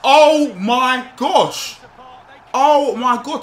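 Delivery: excited shout. Real, not acted. Player hyped after scoring a stunning goal. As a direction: bellow the amazement.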